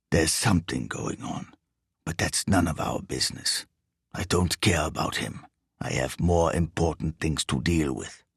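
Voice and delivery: low, quiet, bass-heavy foreign thief; deep foreign voice. A narrator's reading. Riso answers his partner's questions in a low bass voice.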